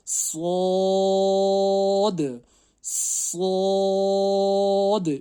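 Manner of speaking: سواد chanted long and thick, high and loud; teacher modelling a thick صاد; heavy stretched letter. Shown as a demonstration. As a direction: recite سواد heavy and drawn out. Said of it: "موٹا پڑھنا ہے".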